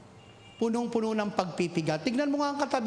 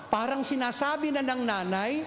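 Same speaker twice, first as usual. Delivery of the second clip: normal talking voice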